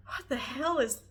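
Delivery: confused disbelief. Retold or imitated. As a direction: retell it in baffled disbelief. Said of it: "I was so confused by it".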